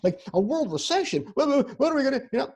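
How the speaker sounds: lightly voiced anxious teen; worried teenager. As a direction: act out a teenager fretting to a parent. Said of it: "let's say you have a fifteen-year-old".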